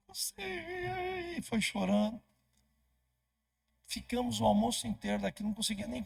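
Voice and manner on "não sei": high, soft, slow tearful reply. Retold or imitated. Weeping mother answering the pastor, high and tearful. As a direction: squeeze it out through tears. Dona Sueli crying, can't explain.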